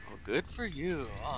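high sarcastic brush-off. Real, not acted. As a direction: dismiss her mockingly. Mocking game character's speech.

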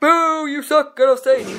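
loud jeering heckle at himself; mock booing. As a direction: boo yourself off stage like a rude crowd.

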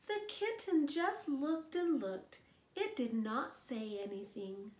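plain reading voice

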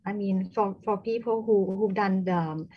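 loud, projecting voice. Real, not acted. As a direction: speak up so they can hear. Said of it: "can, can you speak louder".

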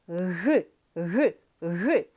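Г repeated in short, separate bursts; short hard Г. Shown as a demonstration. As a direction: sound out a hard Г, brief each time. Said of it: "Короткий, твердий, приголосний". (короткий твердий звук Г, уривчасто)

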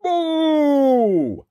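high, loud, drawn-out boom; huge storybook booming syllable; loud giant noise. A narrator's reading. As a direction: blast out the giant's first sound. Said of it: "a loud".

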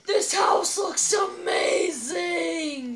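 very drawn-out gushing awe; mock amazement. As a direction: stretch every word in over-the-top wonder. Pretend visitor marvels at house.

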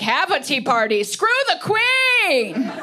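mock-patriotic yell at the end; rebellious rallying cry. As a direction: rouse her to revolt against the Crown. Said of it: "I was like".

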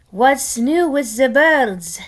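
lightly put-on chef voice, slow; slow, curious character voice; voiced pig chef. Chef asking the returning spy, slow voiced query.

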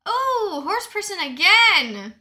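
very loud, drawn-out exclamation; excited outburst. Real, not acted. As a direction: belt out the surprise at the screen.

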